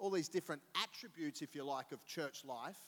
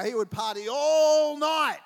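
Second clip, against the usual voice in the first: emphatic joke; 11 semitones higher, 19 dB louder, slower than usual. Real, not acted. Joking about brother's partying.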